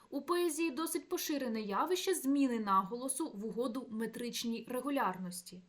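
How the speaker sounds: usual speaking voice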